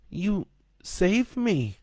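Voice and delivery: put-on high, slow child voice; small boy's high, halting wonder; rescued young boy. A narrator's reading. Rescued boy to Joe who carried him, awed.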